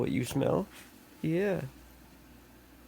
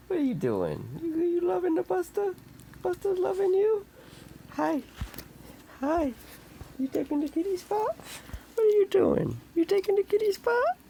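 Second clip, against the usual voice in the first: high pet talk. Real, not acted. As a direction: squeal sweetly at the cats. Cooing to the cats.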